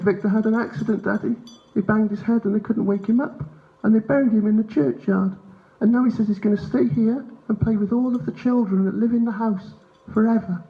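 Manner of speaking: put-on high, innocent child voice; little boy. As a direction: tell it to Daddy like a small son. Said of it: "He said".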